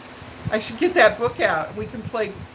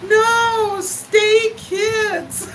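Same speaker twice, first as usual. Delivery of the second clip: put-on high, whiny little-kid plea; toddler voice; 11 semitones higher, 3 dB louder, much slower than usual. Toddler crying to stay with the kids, whiny. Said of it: "and she's like".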